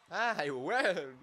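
loud, high victory shout; excited shout. Real, not acted. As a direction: cheer it out. Winning a round.

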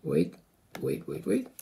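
quietly muttering wait to himself mid-recording; muttered aside. Real, not acted. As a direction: mumble it to yourself while fumbling. Pauses recording, muttering to self.

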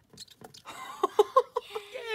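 high, loud breathy squeal of joy; squeal of delight. Real, not acted. The last chick finally eats, a joyful squeal.

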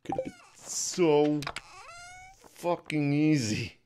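slow, high, loud lament; frustrated outburst. Real, not acted. Player lamenting an easy task missed, drawn-out frustration. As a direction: drag it out loudly in frustration.

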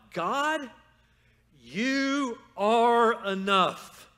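loud, high, drawn-out declaration; out-loud confession. Shown as a demonstration. Preacher modeling a confession to God, loudly proclaimed. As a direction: proclaim it slowly at full voice. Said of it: "you need to say two things out loud".

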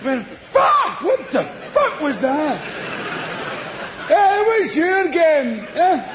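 hammed-up Saint Paul shouting at the heavens; mock outraged yell. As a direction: roar it like a stunned, furious traveller. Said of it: "what do you think he said?".

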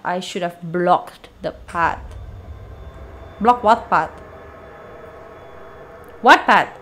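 normal talking voice

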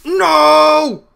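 high, loud cry. Real, not acted. Gamer shouting as he is shot, loud and high. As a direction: yell it out.

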